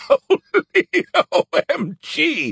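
high, slow laughing shout. A narrator's reading. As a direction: shout through laughter in disbelief. Skippy mocks Joe's ignorance.

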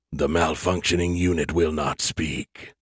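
low, menacing alien entity; cold alien voice. Acted. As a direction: speak as a cold superior alien. Alien creators speak.

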